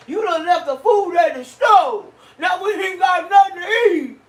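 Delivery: put-on high, loud whining kid voice with laughter; whiny fat kid. Wife imitating husband complaining there's nothing to eat, whiny kid. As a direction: whine like a greedy child. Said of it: "He sounded like a fat kid".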